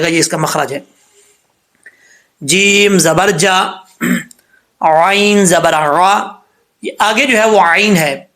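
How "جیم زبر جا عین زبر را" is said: each letter and its vowel drawn out slowly; slow spelled syllables. Shown as a demonstration. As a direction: spell out جیم زبر جا and عین زبر را slowly and clearly. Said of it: "تو کیسے پڑھیں گے؟".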